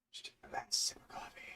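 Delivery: fast whisper to himself; whispered aside. Real, not acted. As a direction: breathe it under your breath, quickly.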